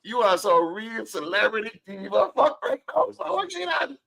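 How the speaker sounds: laughing. Real, not acted. Teasing guest, laughing.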